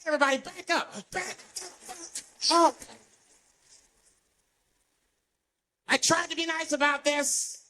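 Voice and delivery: mock crazed warning; flipped-out homeless man. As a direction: threaten the crowd, unhinged. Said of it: "the guy flips out".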